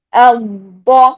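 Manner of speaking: آ held into a doubled, heavy ضّا on با; ضاد with tashdeed. Shown as a demonstration. Teacher models tashdeed, held then released heavy ضّا. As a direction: linger on the closure, then release با full. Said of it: "کیسے".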